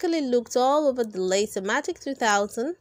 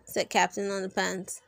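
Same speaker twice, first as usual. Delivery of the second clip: ordinary delivery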